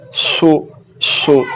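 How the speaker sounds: सो with a sharp whistling s; whistled seen. Shown as a demonstration. Teacher showing how seen sounds, whistled s. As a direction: let the s of सो hiss. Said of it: "सीटी की आवाज़ निकलेगी".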